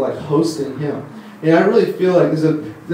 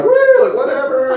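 put-on much higher whooping drunk voice, laughing; carefree drunk; 11 semitones higher, 3 dB louder than usual. Preacher imitating partygoers who don't care, high whooping drunk. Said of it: "They're like".

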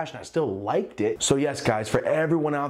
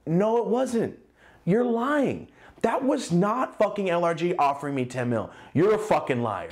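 disbelieving denial; 6 semitones higher than usual. Retold or imitated. Arguing with angry friend in disbelief.

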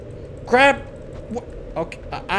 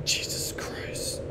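slow whispered curse; breathless whisper; 13 dB quieter, much slower than usual. Real, not acted. Player recovering from a scare, slow whispered curse. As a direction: exhale it in shock. After being startled.

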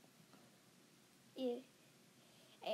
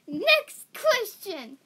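laughing; 3 semitones higher, 8 dB louder, slower than usual. Real, not acted. Host laughing, slowing down.

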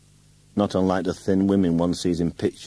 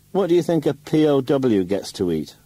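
high, loud bitter demand; husband bitterly asking a loud, high question; 9 semitones higher, 5 dB louder than usual. A narrator's reading. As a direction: throw it back at her bitterly. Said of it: "I asked bitterly".

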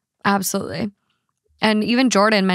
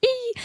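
high squeal; 14 semitones higher, 6 dB quieter than usual. Real, not acted. Squeal of delight.

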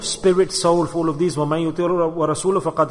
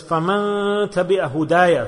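fast, chanted Arabic verse; Quranic recitation; 2 semitones higher, 4 dB louder, much faster than usual. Real, not acted. Preacher quotes the Quran, recited quickly and chanted. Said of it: "Allah Ta'ala says".

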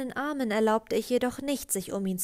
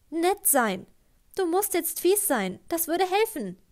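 higher and louder pleading; upset, rising; 7 semitones higher, 5 dB louder than usual. A narrator's reading. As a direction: beg him, voice climbing.

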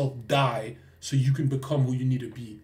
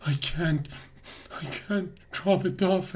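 higher, halting protest; acted distressed patient; 5 semitones higher than usual. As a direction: play a patient who can't do it. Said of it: "And he goes".

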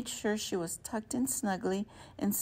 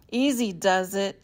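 cautioning; 8 dB louder than usual. A narrator's reading. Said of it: "she cautioned the wind".

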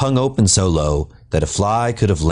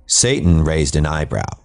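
plain reading voice

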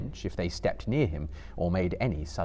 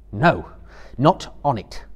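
firm, louder refusal; stern Frodo; 3 semitones higher, 7 dB louder than usual. A narrator's reading. Frodo refusing Gollum's oath, stern and firm. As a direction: cut him off with stern pity. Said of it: "said Frodo".

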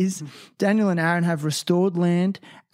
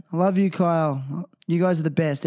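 usual speaking voice

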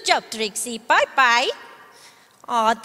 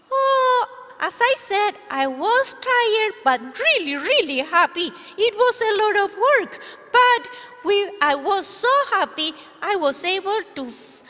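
high, bright little dog voice; puppy puppet; 5 semitones higher than usual. Acted. Puppet dog greeting the kids, much higher and cheerful. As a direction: play the eager puppy.

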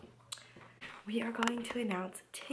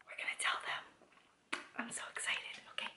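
whisper. Real, not acted. Mom whispering goodbye to the camera before the reveal.

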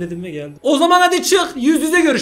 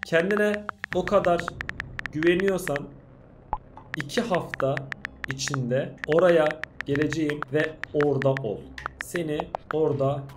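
a soft, sinister villain taunt; menacing villain voice; 8 semitones lower, 8 dB quieter than usual. Acted. Voices the villain nurse's messages.

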